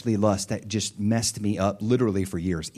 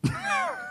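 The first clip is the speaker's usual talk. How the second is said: high laugh; 7 dB quieter than usual. Real, not acted. Laugh after Dad.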